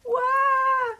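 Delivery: high, loud crybaby "wah"; loud wail. A narrator's reading. Weepy William bursts into tears, high wail. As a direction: wail it out in one long high note. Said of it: "wailed Weepy William".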